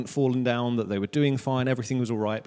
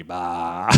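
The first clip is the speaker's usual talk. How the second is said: mock sheep bleat; 7 semitones lower than usual.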